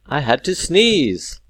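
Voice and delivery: loud, high outburst; exclaimed. Shown as a demonstration. Teacher turns a sneeze into an example, exclaimed. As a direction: blurt it out as an exclamation. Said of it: "It's an exclamation".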